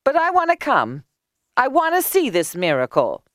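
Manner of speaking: high, insistent character voice; high-pitched eager neighbour; eager woman. A narrator's reading. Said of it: "replied Leah".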